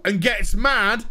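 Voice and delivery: ordinary delivery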